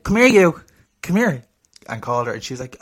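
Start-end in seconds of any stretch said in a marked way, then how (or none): 0.0-1.4 raised, higher-pitched summons; calling someone over. Retold or imitated. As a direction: call her over, re-enacting it. Retells calling the woman over.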